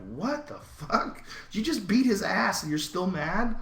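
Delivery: high-pitched, baffled mock question; acted disbelief. Stunned viewer reacting to a still-angry winner, acted incredulity. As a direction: stretch it high, as a stunned onlooker. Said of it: "And you're like".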